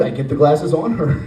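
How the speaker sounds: ordinary talk, nothing marked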